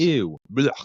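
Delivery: high, loud disgusted groan; mock disgust. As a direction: recoil as if smelling something foul. Reacting to social work major.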